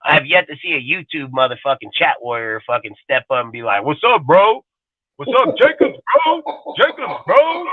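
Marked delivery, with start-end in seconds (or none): 3.8-7.6 high mock bro greeting; squeaky mock challenger voice; mocking tough guy. Mocking a chat warrior stepping up, high and taunting.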